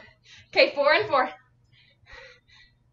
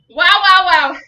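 exhausted wow; 9 dB louder than usual. Real, not acted. Exhausted after set.

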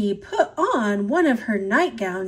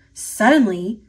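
usual speaking voice

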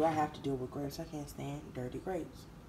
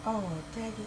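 usual speaking voice

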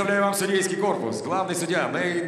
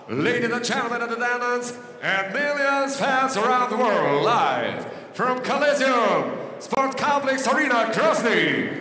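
ringmaster; 5 semitones higher than usual. Acted. English version of the arena call.

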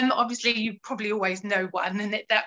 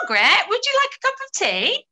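high, loud, sing-song offer of tea; asking Margaret about tea in a bright high voice; cheery offer; 10 semitones higher, 6 dB louder than usual. Shown as a demonstration. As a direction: chirp it like a hostess with a tray. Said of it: "we do a little example of this".

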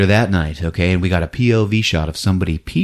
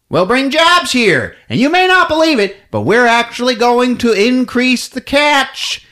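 a hint of a smarmy CEO; salesman executive; 10 semitones higher than usual. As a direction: sell the cannery like a huckster. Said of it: "He's like".

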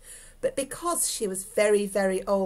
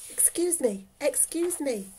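high, light apologetic call; raised-pitch, polite old lady; politely pushing past; 5 semitones higher than usual. Acted. Old lady squeezing through wedding guests.

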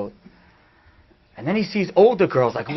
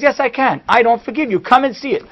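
defiant retort; 7 semitones higher, 3 dB louder than usual. Retold or imitated. Retold argument, standing his ground.

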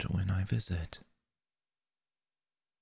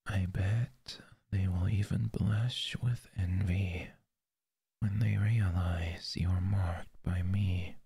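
breathy close-mic whisper. Acted. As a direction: whisper it right into the ear. Whispered roleplay.

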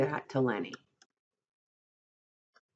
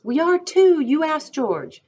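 very high, loud retort; childlike, indignant protest; indignant contradiction; 13 semitones higher, 9 dB louder, slower than usual. A narrator's reading. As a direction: insist loudly, voice shooting up. Character argues back indignantly.

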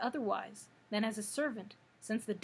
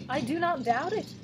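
higher, louder and proud; 5 semitones higher, 5 dB louder than usual. A narrator's reading. Sawhorse boasting to the cab horse, proud higher louder tone. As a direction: say it with wooden pride in being unusual. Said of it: "with a tone of pride".

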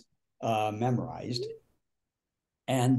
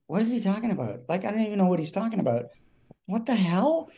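higher, bewildered inner voice; baffled and mildly panicked, pitched up; retold panic; 7 semitones higher, faster than usual. Actor staring at his scene partner, mildly panicked in retelling. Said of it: "panicked 'cause I'm thinking".

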